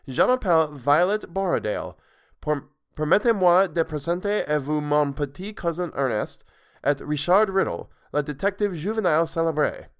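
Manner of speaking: put-on proud formal French with an English accent; girl formally introducing her friends in French; French introduction. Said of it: "that wasn't even Violet's voice".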